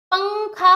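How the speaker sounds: पंखा slowed, with a held nasal ng; nasal anusvara. Shown as a demonstration. Teacher showing what the dot sounds like, nasal पंखा. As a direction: lean on the nasal first syllable of पंखा. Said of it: "अंकी बिंदी लगती है तो उसका उच्चारण".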